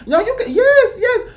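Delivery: very high-pitched imitated yes; squeal-high permission, playfully put on; mock eager approval.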